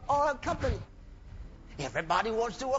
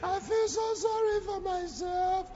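high, slow whiny mock lament; mocking a self-pitying woman, high and slow; 9 semitones higher, slower than usual. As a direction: whimper it with a laugh. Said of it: "every time".

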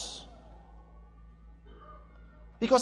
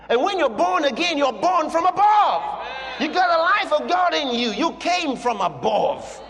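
preaching at full force; 6 semitones higher than usual. Acted. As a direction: ring it out to the church.

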